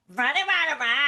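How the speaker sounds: high nasal nonsense babble; nose-pinched. Retold or imitated. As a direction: mimic talking with a pinched nose. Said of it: "I used to pinch my nose".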